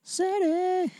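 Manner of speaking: high playful squeak; squeaky silly voice. Pretend. Playing with silly word.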